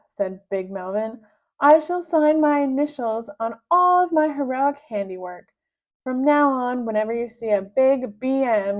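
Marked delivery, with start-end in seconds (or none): 1.6-8.8 high, loud, grandiose boast; pompous villain. Acted. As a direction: announce it like a conqueror.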